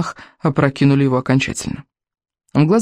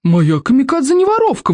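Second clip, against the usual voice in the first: exclaimed; 10 semitones higher than usual. A narrator's reading. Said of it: "воскликнула большегрудая Берта".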